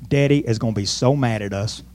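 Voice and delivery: low, hushed brotherly worry; worried aside. Acted. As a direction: confide it softly, as a worried brother. Acting brothers worrying in the corner.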